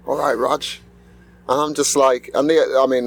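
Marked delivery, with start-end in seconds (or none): 0.1-0.8 put-on Irish accent. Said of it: "with his Irish accent".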